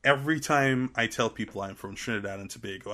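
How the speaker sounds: usual speaking voice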